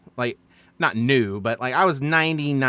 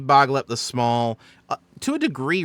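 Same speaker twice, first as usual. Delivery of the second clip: normal talking voice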